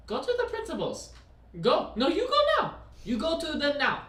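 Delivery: high, loud teacher order in a mock Chinese accent; teacher ordering stoned student out, mock Chinese accent. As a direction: send him off in a hammed-up Chinese accent. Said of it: "like, a Chinese accent".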